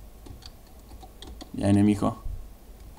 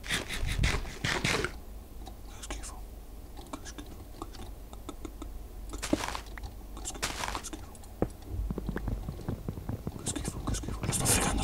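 whispering; 18 dB quieter, faster than usual. Real, not acted. Repeated curse while hiding.

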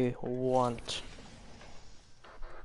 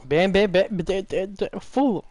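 excited; 5 semitones higher, 3 dB louder than usual. Real, not acted. Excited gunfire callout.

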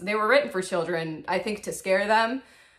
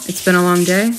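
louder, rising in disbelief; loud questioning echo; 12 dB louder than usual. Acted. Echoing her own words back, loud and doubtful. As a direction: throw the line back as a question. Louder repeat answering herself.

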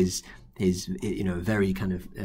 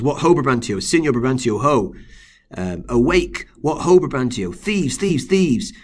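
raised shouting call to wake the house; 5 semitones higher, 7 dB louder than usual. Acted. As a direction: shout up at the window in alarm. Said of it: "Rodrigo says".

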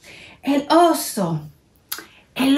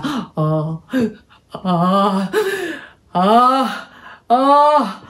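building sneeze; 2 semitones lower, much slower than usual. Acted. Bear about to sneeze.

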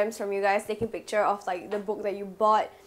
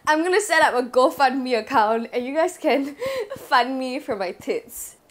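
laughing; 4 semitones higher, 6 dB louder than usual. Real, not acted. Joking about GoFundMe for breasts.

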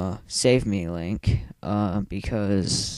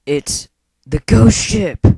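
loud, high, drawn-out mock drama; dramatic exclamation; 9 semitones higher, 12 dB louder, slower than usual. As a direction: announce it big and slow, hammed-up. Dramatic reading of game text.

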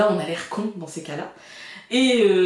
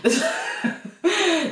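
rire; 10 semitones higher than usual. Real, not acted.

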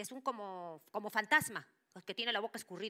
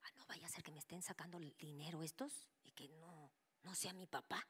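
suspicious whisper; 10 dB quieter than usual. Retold or imitated. Doubting thought about the medium.

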